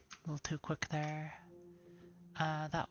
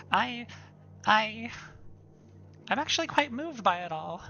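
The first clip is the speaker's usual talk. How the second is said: excited nerd; 6 semitones higher, 8 dB louder than usual. Acted. Voices moved excited historian NPC.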